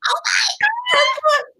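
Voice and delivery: high whispered squeal of joy; breathless squeal. Real, not acted. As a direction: squeal it under your breath. Overjoyed about podcast invites.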